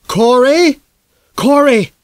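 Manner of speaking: high desperate calling out; calling down to his trapped son; shouting a name. A narrator's reading. Father yelling to his son through the broken window, high and loud.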